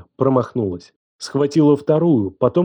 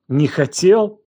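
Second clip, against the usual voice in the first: high, incredulous echo; near-hysterical; 6 semitones higher, slower than usual. A narrator's reading. Елена scoffs at his excuse, near-hysterical. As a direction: throw it back in wild disbelief. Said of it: "Она рассмеялась истерически".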